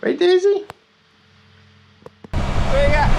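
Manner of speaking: high soft coo to the dog. Real, not acted. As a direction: sweet-talk the dog. Cooing at the dog.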